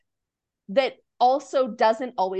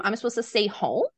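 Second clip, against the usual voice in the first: usual speaking voice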